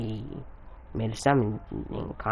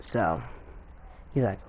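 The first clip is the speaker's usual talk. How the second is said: ordinary delivery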